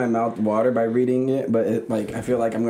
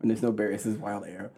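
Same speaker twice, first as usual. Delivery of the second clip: usual speaking voice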